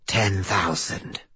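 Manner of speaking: eerie inhuman voice. Acted. Mysterious inhuman voice on phone.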